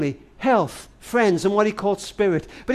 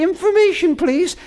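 mother calling; 10 semitones higher than usual. Retold or imitated.

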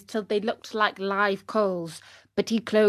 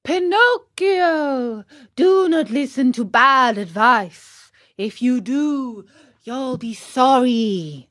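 blackbird calling a slow, drawn-out warning; raised put-on bird voice, stretching each phrase; sharp, clear call; 4 semitones higher, 5 dB louder, slower than usual. A narrator's reading. Blackbird warning Pinocchio from the fence, calling out clearly. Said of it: "called out sharp and clear".

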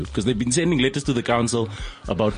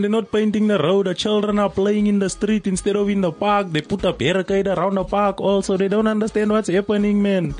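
higher, worked-up local resident voice; a fired-up resident reporting to the radio studio, pitched up; Eric Janssen; 6 semitones higher than usual. Acted. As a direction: play an excited eyewitness from the neighbourhood. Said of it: "Eric Janssen is on the line".

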